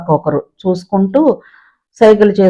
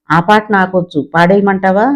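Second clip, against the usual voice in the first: usual speaking voice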